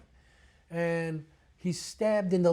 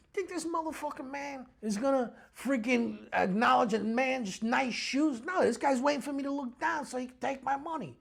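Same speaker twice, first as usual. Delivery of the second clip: high-pitched, worked-up explanation; retold heated insistence; indignant retort; 7 semitones higher than usual. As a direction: argue back, incredulous. Retold agitated retort to daughter.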